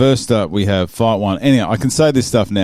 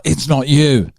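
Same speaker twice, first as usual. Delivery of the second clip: higher-pitched retold snippy denial; touch aggressive; 5 semitones higher, slower than usual. Insisting to the man she doesn't recognise, touch aggressive. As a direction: imitate an irritated young woman, pitched up. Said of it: "a touch aggressive young lady".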